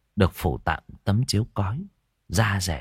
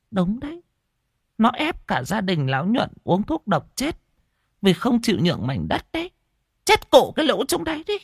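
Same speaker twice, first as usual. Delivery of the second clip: gossiping village woman; 13 semitones higher than usual. Acted.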